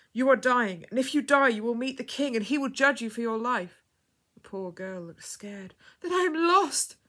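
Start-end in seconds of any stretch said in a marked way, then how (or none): 0.1-3.8 loud, raised warning; urgent. A narrator's reading. Woman warning the dying girl, urgently. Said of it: "she said urgently". 6.0-7.0 high, loud despairing cry; weeping. A narrator's reading. Dying girl fearing judgement, tearful high cry. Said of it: "she wept".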